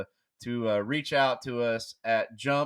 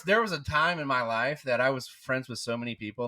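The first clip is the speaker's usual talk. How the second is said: normal talking voice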